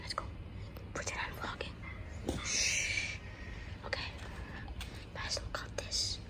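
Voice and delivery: hushed whisper near target. Real, not acted. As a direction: secret prankster whisper. Whispering hiding behind couch.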